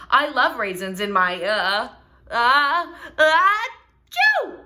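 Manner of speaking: builds up to a loud high sneeze; sneeze mid-sentence. Acted. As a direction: let the sneeze build on uh and burst out on chew.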